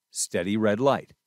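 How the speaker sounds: normal talking voice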